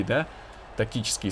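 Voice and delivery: normal talking voice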